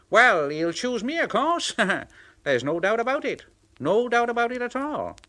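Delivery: high, quick bragging; boastful James. Acted. James the red engine boasting he'll be chosen. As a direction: crow with confidence.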